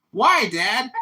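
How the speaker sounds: mock son. Max answers dad in skit.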